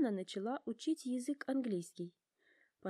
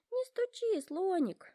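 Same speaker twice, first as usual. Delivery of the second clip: much higher, louder stern scolding; stern giraffe; 11 semitones higher, 4 dB louder, slower than usual. A narrator's reading. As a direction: scold him sharply, high and loud. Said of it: "строго говорит Жираф".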